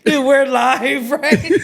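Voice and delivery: high slow disbelieving; suddenly worried we're live; alarmed. Real, not acted.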